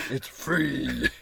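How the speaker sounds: loud, hammed-up lemonade seller; commanding puberty voice. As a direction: belt it out like a bossy cracked-voice teen. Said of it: "my voice was so commanding".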